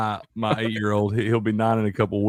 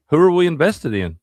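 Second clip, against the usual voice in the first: lightly voiced boy, higher and louder; imitated young son; 8 semitones higher, 5 dB louder than usual. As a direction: pipe up with a kid's eager question. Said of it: "then my oldest son".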